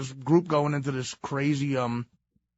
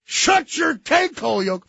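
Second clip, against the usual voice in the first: loud insult; 11 semitones higher, 6 dB louder than usual. Retold or imitated. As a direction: spit out the insult with relish. Repeating punchline.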